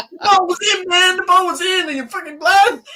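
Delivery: John McEnroe American rant. Retold or imitated. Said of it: "That's my American accent there".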